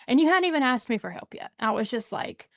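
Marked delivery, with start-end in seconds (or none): none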